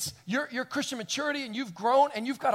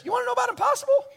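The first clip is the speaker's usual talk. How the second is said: high, fast, loud challenge; Abraham's challenge; 5 dB louder, much faster than usual. Retold or imitated. Abraham challenging the servant, high and fast. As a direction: fire the question back at him. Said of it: "Abraham says".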